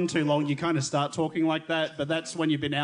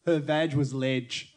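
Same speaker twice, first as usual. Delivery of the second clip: mock Aussie slang; 3 semitones lower, much slower than usual. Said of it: "a phrase that Jeff swears was told".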